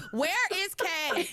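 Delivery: retold exasperation, stretching the words; exasperated demand. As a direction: wail it slowly in mock despair. Retold exasperated wait for a character.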